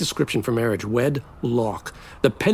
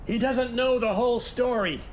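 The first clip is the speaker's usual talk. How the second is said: 1 angry retort; 10 semitones higher than usual. Acted. Couple quarrelling in front of guest.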